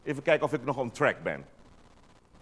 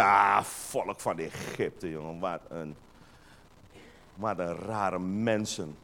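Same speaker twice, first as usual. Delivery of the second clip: mock disdain; 10 semitones lower, 5 dB quieter, slower than usual. Mocking judgemental churchgoers.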